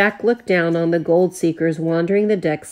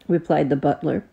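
ordinary delivery, nothing marked

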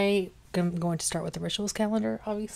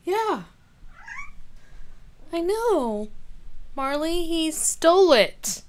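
much higher sing-song voice to the cat; 10 semitones higher, 4 dB louder than usual. Real, not acted. Talking to the cat.

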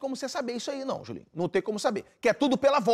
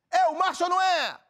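grito agudo de apresentador de auditório; anúncio gritado de quadro; 8 semitones higher, 4 dB louder than usual. Acted. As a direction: berre o nome do quadro novo. Apresentador anuncia o novo quadro. (bordão de programa, anunciado aos gritos)

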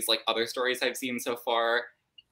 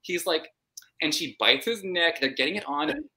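ordinary talk, nothing marked

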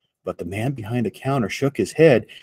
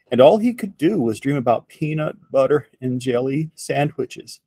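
ordinary delivery, nothing marked

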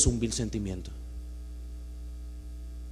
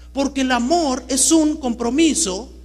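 ordinary talk

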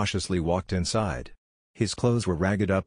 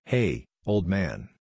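slow, offhand hello to an elder; cheeky greeting; much slower than usual. A narrator's reading. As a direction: drawl it out disrespectfully. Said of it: "Kushina greeted".

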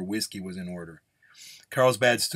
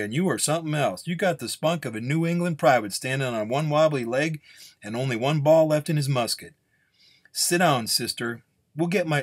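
higher, emphatic storytelling gunslinger; amused gunslinger; 4 semitones higher, 4 dB louder than usual. Acted. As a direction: tease the nun, then start questioning. Gunslinger sizes up the nun.

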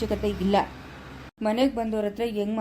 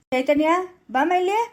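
higher, raised voice; calling someone over; 6 semitones higher, 4 dB louder than usual. Acted. Father-in-law calling daughter-in-law to explain, raised and high. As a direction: summon her from across the house.

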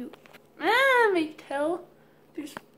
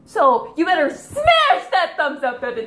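excited outro shout; 13 semitones higher, 14 dB louder, slower than usual. Real, not acted. Loud laughing vlog outro.

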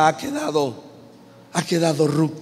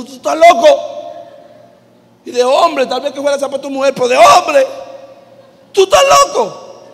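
higher, louder outraged shout; indignant yell; 9 semitones higher, 10 dB louder than usual. Acted. Ruth yelling indignantly at Booz over a shoe. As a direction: blow up at him in disbelief.

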